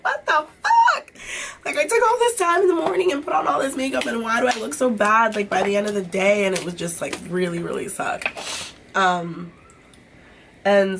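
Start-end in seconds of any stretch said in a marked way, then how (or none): 0.0-6.5 exasperated high-pitched rant at the mirror; mock outrage. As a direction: squeal it out half laughing, half crying. Exasperated at own makeup failing.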